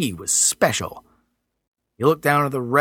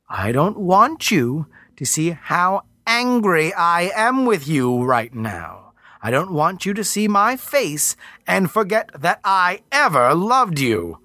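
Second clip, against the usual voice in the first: slow, otherworldly Earth Mother; slow angry goddess; 6 semitones higher than usual. Acted. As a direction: hold back fury, slowly.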